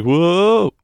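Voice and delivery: high, loud, excited whoa; hammed-up startled whoa; mock whoa. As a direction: yelp it like sliding down a tarp. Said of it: "and it's like".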